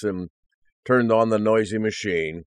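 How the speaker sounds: ordinary delivery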